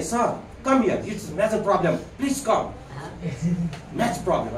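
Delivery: lightly put-on English accent, r dropped; a hint of a native English speaker, soft r; mock English accent. Teacher mimics an English speaker for pupils, light English accent. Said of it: "असं".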